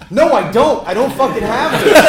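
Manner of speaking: loud, laughing mock panic; panicked outburst. Panicked reply in retold story.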